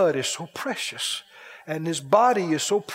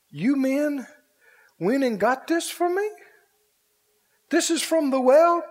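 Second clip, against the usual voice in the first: awed, higher-pitched disbelief, rising at the end; amazed question; 6 semitones higher than usual. Acted. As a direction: ask as a king stunned by the gift. David amazed at the gift.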